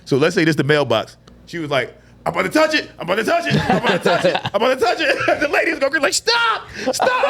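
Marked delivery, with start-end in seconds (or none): 2.2-5.4 high, teasing repeated provocation; playful high-pitched taunt; petty taunting. Retold or imitated. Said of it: "same type of petty". 6.1-7.3 extremely high squealed plea; high squeal, played for laughs; mock protest. Acted lady begging taunter to stop.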